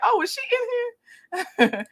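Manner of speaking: high, surprised little gasp of a question; mock surprise. Host retelling spotting a chat regular, mock surprised. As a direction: act like you just spotted her. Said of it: "and I'm like".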